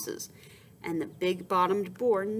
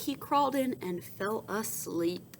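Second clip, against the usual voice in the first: ordinary delivery, nothing marked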